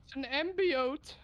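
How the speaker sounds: high sweet voice. Pretend. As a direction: coo it like a proud parent. Fake pregnancy announcement continues.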